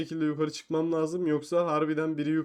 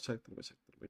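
hushed conspiratorial whisper; 7 semitones lower, 20 dB quieter than usual. Real, not acted. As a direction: whisper so nobody notices. Sneaking up on a player.

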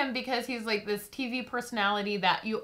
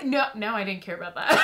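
ordinary delivery

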